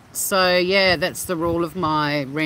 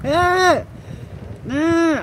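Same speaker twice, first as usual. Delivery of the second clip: loud high protest; 11 semitones higher, 3 dB louder than usual. Real, not acted.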